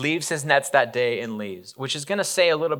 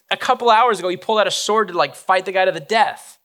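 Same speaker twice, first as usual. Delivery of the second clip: emphatic; 7 semitones higher, 5 dB louder than usual. Real, not acted. Preacher's emphatic aside.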